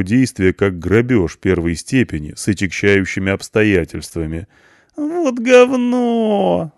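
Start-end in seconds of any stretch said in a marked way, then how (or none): 5.0-6.7 very high, slow whining wail. A narrator's reading. Punk whines at the charge, drawn-out high wail. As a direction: drag the complaint out in a whine. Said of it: "заныл Панк".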